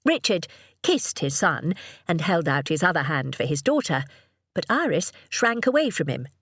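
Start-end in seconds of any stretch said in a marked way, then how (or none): none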